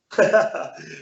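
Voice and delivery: high burst of laughter. Real, not acted. Laughing at himself.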